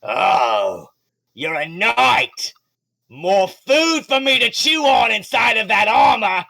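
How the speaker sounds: high, loud, slow gloating monster shout; menacing shark man. Acted. Shark man taunting the knight in armor, loud gloating. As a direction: taunt the knight like a hungry brute. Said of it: "He's like".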